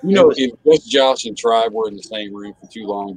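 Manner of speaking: normal talking voice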